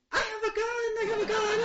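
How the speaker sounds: high, giddy, laughing brag; excited boast. Retold or imitated. Telling her sisters she got a gun, giddy and laughing. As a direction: gleefully announce it like a kid with a new toy.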